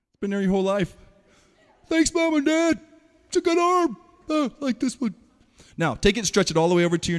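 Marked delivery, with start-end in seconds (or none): 1.9-5.1 put-on high, louder goofy kid voice; joking to the class in a goofy voice; silly kid voice. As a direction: play a proud child thanking parents. Jokey aside about the arm.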